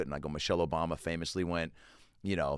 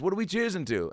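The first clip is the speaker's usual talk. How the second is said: higher Liverpool-tinged impression; 10 semitones higher than usual. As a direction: lift it up like a chirpy Liverpudlian. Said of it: "He goes".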